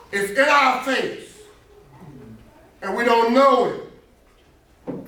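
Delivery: loud, high, emphatic preacher voice; impassioned preaching. Real, not acted. Teacher driving a Bible point home to the class, loud impassioned preaching. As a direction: preach it out, stretching the big words.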